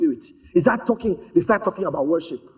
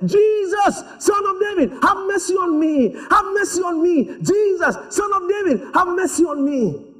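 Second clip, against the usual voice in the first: high, drawn-out cry for mercy; desperate shouting; 11 semitones higher, slower than usual. Acted. Blind beggar shouting to Jesus for mercy, desperate high cry. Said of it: "blind Bartimaeus was shouting".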